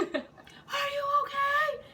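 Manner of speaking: very high, long-drawn squeal; exaggerated calling out from a distance; mock concerned call. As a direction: stretch it out in a high mock holler. Repeats the imitated concerned caller.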